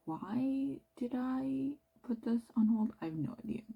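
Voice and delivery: ordinary delivery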